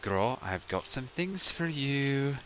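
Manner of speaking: slow, coaxing condescension; patronizing. Acted. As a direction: dangle a treat with drawn-out words. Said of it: "That's really patronizing".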